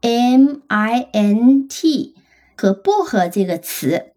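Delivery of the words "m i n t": slow spelling. Shown as a demonstration. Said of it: "再加上第三个音节的辅音".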